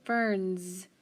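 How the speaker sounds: ferns with the final S buzzed as a Z. Shown as a demonstration. Teacher pointing out the buzzing Z at the end of ferns. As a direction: make the S in ferns a clear Z sound. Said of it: "But listen to that S".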